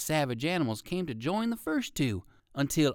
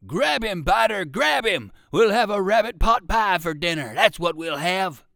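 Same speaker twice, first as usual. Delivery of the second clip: higher, loud gruff shout; 5 semitones higher, 9 dB louder than usual. A narrator's reading. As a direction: holler at the dog to grab its prey.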